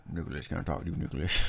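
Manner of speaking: low, soft creepy man; scary neighbor voice. Retold or imitated. Imitating the neighbor calling them by full name, low and creepy. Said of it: "he talks like this".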